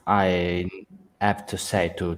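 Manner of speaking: ordinary talk, nothing marked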